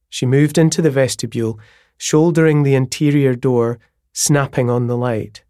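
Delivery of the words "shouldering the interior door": ordinary delivery, nothing marked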